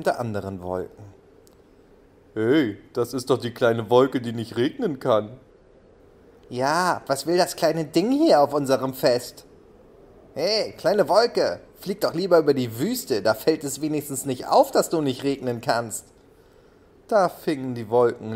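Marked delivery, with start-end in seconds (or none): 2.3-5.4 loud, jeering voice of a bully cloud; mocking cloud. Cloud mocks the little cloud at the feast, jeering loudly. 6.5-16.1 higher, louder sneering voice; jeering cloud. Acted. Cloud tells the little cloud to go to the desert, sneering loudly. Another cloud jeers at newcomer.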